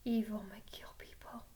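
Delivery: hushed, panicked, breathless whisper. Acted. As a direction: gasp the name in fear. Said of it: "Chuck panicked".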